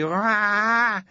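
sudden high, loud infant shout; a toddler's outburst; bratty baby bellow; baby yell. Acted. Baby abbot bangs high chair.

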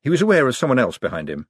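ordinary delivery

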